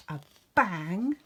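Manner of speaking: ordinary delivery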